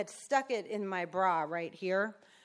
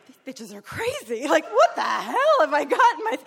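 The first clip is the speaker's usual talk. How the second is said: high, loud, laughing incredulous inner voice; laughing disbelief; 9 semitones higher, 11 dB louder than usual. Retold or imitated. Newcomer thinks her new friends are crazy, laughing. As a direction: laugh it out like you can't believe it. Own panicked thoughts, laughing.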